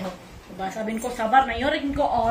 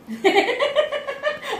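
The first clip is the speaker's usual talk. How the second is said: high burst of laughter; 13 semitones higher, 3 dB louder than usual. Real, not acted. Mother laughing at her children's fight, high. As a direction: laugh out at the squabble.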